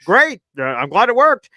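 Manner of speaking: high, loud sarcastic oh great; loud mock cheer. Friend gloating to a grumbling fan, loud mock cheer. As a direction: burst out with fake delight. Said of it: "And he's like".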